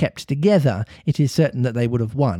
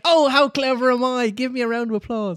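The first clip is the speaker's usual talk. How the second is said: smug Peter; 12 semitones higher than usual. Pretend. Imagining Peter boasting to the Avengers, high smug voice. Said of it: "He'd just be like".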